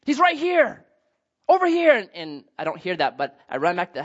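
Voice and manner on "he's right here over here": higher, louder relieved searcher calling; imagined voice calling out good news; hoped-for shout. Pretend. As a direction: call out like a neighbour who found him. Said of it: "wanting to hear somebody say".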